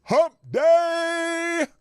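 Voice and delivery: high, loud playful exclaim; excited exclaim. Real, not acted. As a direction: sing out the day like a cheer. Naming the day with flair.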